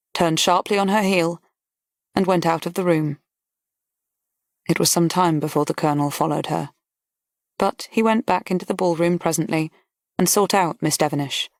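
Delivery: ordinary delivery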